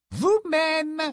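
very high, playful put-on voice; mischievous painted lady. A narrator's reading. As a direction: toss it back with a coy smile. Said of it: "répliqua-t-elle avec un sourire coquin".

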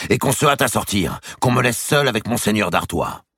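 loud, high shout for the servant. Acted. The king yelling at his chamberlain for his robe, loud. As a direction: bark the order across the room. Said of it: "cria-t-il".

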